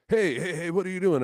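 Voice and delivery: high, loud "caught you" challenge; challenging call. Retold or imitated. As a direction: call out sharply to stop trespassers. Stranger challenges kids by the stage.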